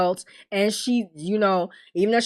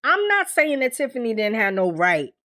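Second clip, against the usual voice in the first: ordinary delivery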